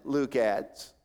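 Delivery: ordinary talk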